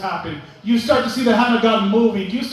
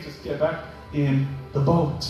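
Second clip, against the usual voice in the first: soft, slow Jesus; 8 semitones lower, 4 dB quieter than usual. Retold or imitated. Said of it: "And Jesus saying".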